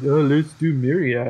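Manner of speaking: slow, lazy take-the-default drawl; mock the lazy designer picking the default; imitating a lazy designer, slowed down. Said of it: "everyone just is lazy and is like".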